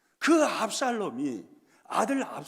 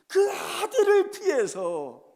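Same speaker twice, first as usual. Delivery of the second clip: tearful; 10 semitones higher, 7 dB louder, slower than usual. Real, not acted. David fleeing, tearful preaching.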